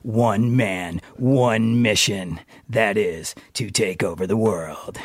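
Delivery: deep, slow movie trailer voice; low dramatic trailer announcer; sultry narrator. Pretend. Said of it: "The downtown D J voice".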